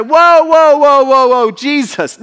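high, loud whoa whoa, hands-up protest. Retold or imitated. Disciple jumping up to protest to his teacher, loud startled whoa. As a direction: jump up and shout it down. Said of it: "He's like".

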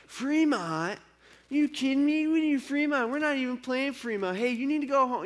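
high-pitched heckling jeer; mocking heckle. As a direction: taunt the kid from the bleachers. Older fan taunting at the game.

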